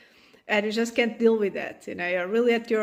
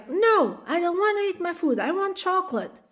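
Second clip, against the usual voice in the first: put-on high, whining little kid; child refusing dinner, high and whiny; 6 semitones higher than usual. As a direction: whine it fast like a toddler wanting sweets.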